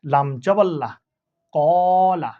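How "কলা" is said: "কলা" with its first vowel held long and level; drawn-out vowel. Shown as a demonstration. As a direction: stretch the first syllable of "কলা", then a short ending. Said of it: "এক আলিফ টানতে হবে". ("কলা"-র প্রথম স্বর এক আলিফ টেনে)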